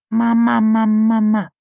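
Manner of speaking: put-on high, loud toddler babble; little boy. A narrator's reading. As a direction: call out like a tiny child.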